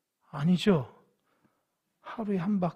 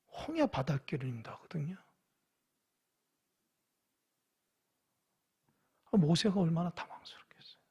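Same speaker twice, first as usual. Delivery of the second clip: whisper; 2 semitones lower, 9 dB quieter than usual. Real, not acted. Aside on Moses' dilemma.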